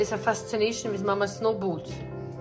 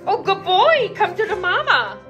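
high, loud coaxing of a pet; dog-praise voice; 9 semitones higher, 8 dB louder than usual. Real, not acted. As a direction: gush praise to bring the dog over.

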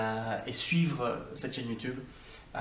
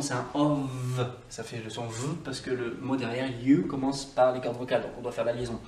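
ordinary delivery

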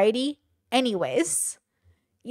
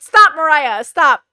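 higher, louder playful self-scold; scolding herself; 4 semitones higher, 9 dB louder, slower than usual. Real, not acted. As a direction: snap at yourself to quit rambling. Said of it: "I know, I'm being a mess".

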